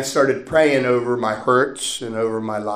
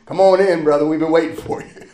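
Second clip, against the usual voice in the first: higher, louder welcoming call; playful voicing of a welcome; imagined welcoming fathers; 4 semitones higher, 5 dB louder than usual. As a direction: call a brother in heartily. Imagined fathers calling him into heaven.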